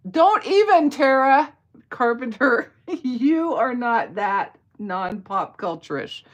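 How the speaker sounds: loud, high playful telling-off; mock scolding. Real, not acted. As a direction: scold a friend in exaggerated disbelief.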